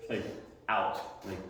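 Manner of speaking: usual speaking voice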